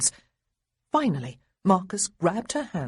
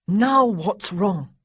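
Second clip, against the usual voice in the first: louder, slow, fed-up question; exasperated; 3 semitones higher, 7 dB louder, slower than usual. A narrator's reading. Big brother asks his sister in exasperation. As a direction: sigh it out, annoyed.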